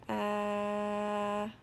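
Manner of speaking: steady, monotone "uhhh" held long; sustained "uh" sound. Shown as a demonstration. Teacher models the sounds on the page, sustained "uh". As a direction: hold the vowel on one even note. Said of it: "tell me all of the sounds".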